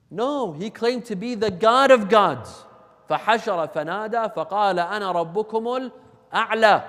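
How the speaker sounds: loud, high proclamation into recitation; ringing sermon declaration; forceful preaching. Acted. Preaches at full force, then recites.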